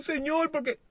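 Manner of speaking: high, pleading whine to the Lord; whiny complaining believer. Retold or imitated. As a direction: imitate a self-pitying complaint, voice up high. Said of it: "y comienzan ahí".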